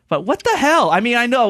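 high-pitched indignant outburst; real exasperation. Real, not acted. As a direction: protest in disbelief, voice shooting up. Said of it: "I'm so mad".

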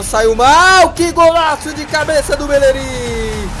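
high, loud drawn-out goal shout; goal call shout. Real, not acted. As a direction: yell the goal call, stretching it.

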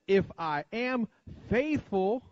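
emphatic, spaced "if I am faithful", last word high; emphasis on each word. Shown as a demonstration. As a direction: stretch "if I am", then punch "faithful" on a rise. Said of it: "put emphasis on each word".

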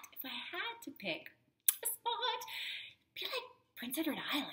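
quick confiding whisper; hushed confession. Real, not acted. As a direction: whisper it fast like a secret. Whispered secret favourite.

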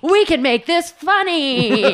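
high, slow, gleeful mock enthusiasm; retelling her eager student pitch to the podcast hosts, high and slow; mock eager younger self. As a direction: play your naive student self pitching a joke. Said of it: "and I was like".

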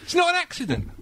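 NOT hit hard and high; indignant, stressing not; emphatic denial. Real, not acted. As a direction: insist it's not an accident, punching not. Indignant rebuttal.

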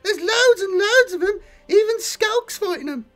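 very high, loud squeaky goblin, near crying; frantic report. Acted. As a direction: gabble out the bad news in panic. Grot reports the battle.

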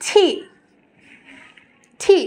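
"টি" with a strong puff after t; correct aspirated T. Shown as a demonstration. Teacher models correct T, aspirated "টি". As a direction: burst air out on "টি". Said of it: "আমরা এখন থেকে বলবো".

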